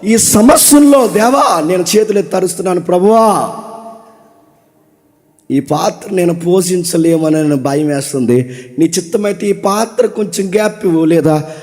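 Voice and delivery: acted tearful prayer. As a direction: cry out to God, then let it fade into a humble plea. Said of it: "ప్రార్థనలో".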